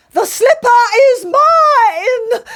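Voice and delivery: high wailing cry of protest; cried. A narrator's reading. Ugly sister wailing at the messenger, high and crying. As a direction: wail it like a spoilt sister. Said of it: "cried Imelda".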